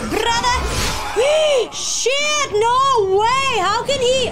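high, loud cries of alarm; sudden real panic, yelling; panicked shout. Real, not acted. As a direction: scream out for your brother. Panics as teammate is attacked.

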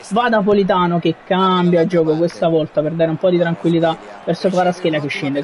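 ordinary talk, nothing marked

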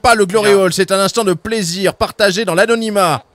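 loud, high indignant scolding; loud, high, outraged official; indignant outburst. Acted. Governor scolds, fining Grub.

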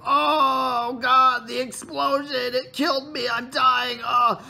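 high, hammed-up death cries; mock agony, loud and high; dying spider. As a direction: wail melodramatically as an exploded spider. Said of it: "it'd be like".

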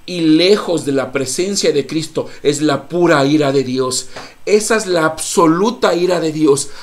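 loud, rising sermon on wrath; fiery preaching. Acted. Pastor warning the church of hell, loud preaching. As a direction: preach it hard to the congregation.

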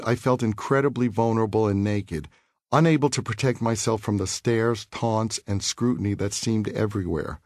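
ordinary delivery, nothing marked

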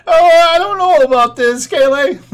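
a nervous Morty pulled into something. Acted. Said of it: "is totally Morty".

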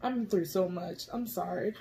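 soft, tearful confession; really crying. Real, not acted. Explaining the tears to viewers, quietly crying. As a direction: speak quietly through real tears. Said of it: "I was not expecting to cry".

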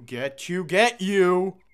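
rising to a loud high pounce; pouncing yell. Acted. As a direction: lunge on the last word. Predator lunges.

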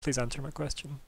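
captain quietly insisting; polite but firm. Acted. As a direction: press quietly for an answer. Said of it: "intrigued eyes, but at the same time".

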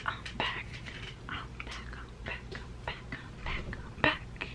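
soft whispered repeats; whispering. Real, not acted. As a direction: breathe it, barely voiced. Whispering while returning.

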